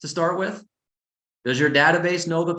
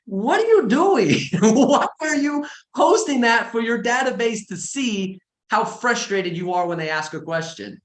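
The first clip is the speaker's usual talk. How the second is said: exasperated disbelief; 8 semitones higher than usual. Retold or imitated. Coach asks agents why they post frustrated memes, incredulous and laughing. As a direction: ask the agent what on earth they're doing. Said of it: "I'm like".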